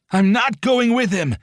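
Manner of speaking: high, loud, slow screaming refusal; tearful furious scream; desperate scream. A narrator's reading. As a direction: scream it on the edge of tears. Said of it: "he screamed".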